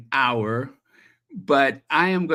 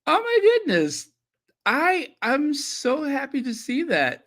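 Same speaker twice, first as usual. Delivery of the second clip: overjoyed; 12 semitones higher than usual. Real, not acted. Touched by chat messages.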